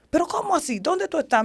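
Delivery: imitating a mother demanding answers; alarmed mother. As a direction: question your lost daughter in disbelief. Acts mother alarmed on phone.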